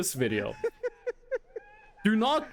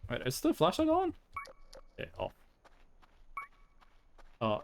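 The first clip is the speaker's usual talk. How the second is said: normal talking voice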